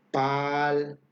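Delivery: "pal" with the a held twice as long; exaggerated long a in "pal"; stretched long vowel. Shown as a demonstration. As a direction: hold the a of "pal" long and steady. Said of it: "voy a exagerar un poquito el sonido".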